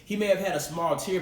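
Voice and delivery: ordinary talk, nothing marked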